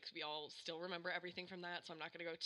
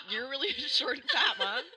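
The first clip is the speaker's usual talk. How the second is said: mocking through laughter; laughing mocking son; 6 semitones higher, 13 dB louder than usual. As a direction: tease her while laughing. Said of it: "He's like".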